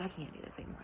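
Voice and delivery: breathy near-whisper, worn out. Retold or imitated. To herself about her hair falling out, a defeated near-whisper. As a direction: whisper it like you've given up.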